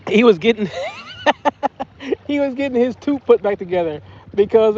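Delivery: laughing while telling it. Real, not acted. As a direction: get the words out through a laugh.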